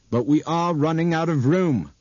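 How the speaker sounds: tense pilot. A narrator's reading. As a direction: push it out under strain. Pilot tense near ground.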